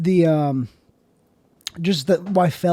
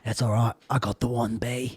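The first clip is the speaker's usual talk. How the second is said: low, softer action hero; Jason Statham; 7 semitones lower, 8 dB quieter than usual. Acted. Star answering that bees died, low and stoic. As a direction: stoic put-on tough guy. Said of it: "Jason, all the bees died".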